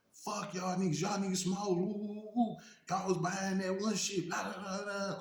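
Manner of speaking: high, heated mock tirade at the gang; retold furious rant. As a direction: imitate her going off on them. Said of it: "she going hard on him".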